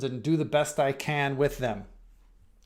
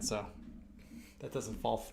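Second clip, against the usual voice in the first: usual speaking voice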